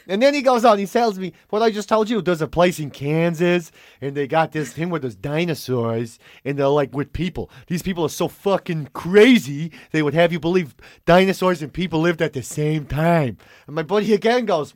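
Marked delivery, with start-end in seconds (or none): 1.5-13.4 intense New Yorker. Retold or imitated. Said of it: "he's very intense".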